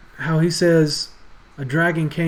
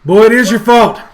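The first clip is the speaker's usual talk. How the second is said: put-on furious father yelling at his boy; much louder, higher outburst of blame; angry yell; 8 semitones higher, 14 dB louder than usual. Father blaming his son for the loss, angry yell. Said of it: "begins yelling at him".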